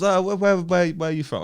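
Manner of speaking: put-on Greek accent. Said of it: "I don't know if that's a Greek accent".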